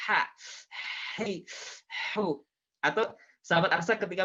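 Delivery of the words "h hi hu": "H, hi, hu" each after a long throaty breath; spicy-mouth H. Shown as a demonstration. Teacher showing how chilli heat gives the letter, breathy "H, hi, hu". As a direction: pant out "H, hi, hu" like chilli burning the throat. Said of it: "bagaimana ketika kita kepedesan".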